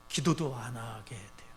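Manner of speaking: slow hushed whisper; preacher whispering to draw the hearers in. Real, not acted. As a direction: drop the voice to a breath.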